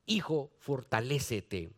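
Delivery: low, soft fatherly encouragement; tender fatherly voice. Acted. Paul tenderly urging his son Timothy, low and soft.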